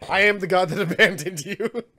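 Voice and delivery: lightly hammed-up god declaring himself, laughing; mock almighty god. Game master to players who call him their god, mock almighty.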